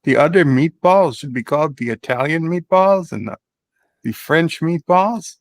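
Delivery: put-on Swedish accent, played for laughs. Claiming the Swedish family invented the meatball, in a put-on Swedish accent. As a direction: lean into a comic Swedish accent. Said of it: "You're sounding very Swedish here".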